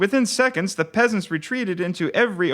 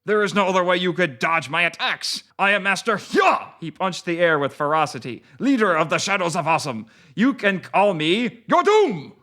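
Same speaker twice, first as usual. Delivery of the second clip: put-on gruff Japanese-accented ninja. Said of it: "in a gruff Japanese-sounding accent".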